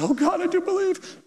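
very high and breathless with wonder; excited woman's voice. Retold or imitated. The cat owner cries out to God, high and thrilled. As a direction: squeal it out as belief dawns.

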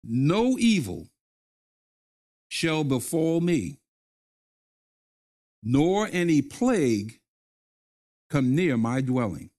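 slow, phrase by phrase, pausing for the echo; slow call-and-response. Shown as a demonstration. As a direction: lead the listeners through it line by line. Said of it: "Say this with me".

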